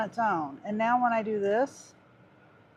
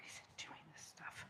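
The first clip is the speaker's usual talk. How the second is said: whispered under her breath at the phone; frustrated whisper; 5 semitones lower, 28 dB quieter than usual. Real, not acted. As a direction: breathe it out, voiceless and exasperated.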